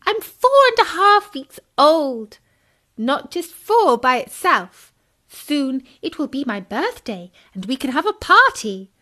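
put-on high, loud excited child voice; little tadpole Tim. Tadpole boasting to Bertie about his age. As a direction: bounce it like a thrilled little kid.

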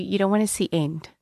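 usual speaking voice

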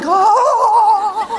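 long, high, loud wail; comic wavering yell of panic; mock terrified scream. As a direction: scream like a kid careering downhill. Child hurtling downhill on tricycle.

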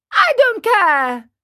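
put-on high, loud, slow childish retort; drawn-out bratty defiance; defiant tantrum. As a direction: throw it back like a stubborn boy. Sick puppet boy defies the fairy.

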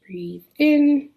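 gentle rising breathing cue. Acted. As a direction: invite a slow inhale.

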